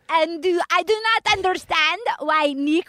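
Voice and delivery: hammed-up French Canadian accent; plaintive, badly faked French Canadian accent; [name]. Contestant puzzled about Nick, hammed-up French Canadian accent. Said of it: "Your, your French Canadian sounded like".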